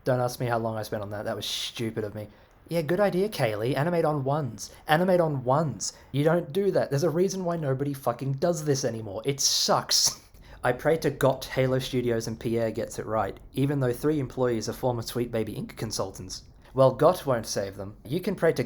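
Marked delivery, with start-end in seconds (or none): none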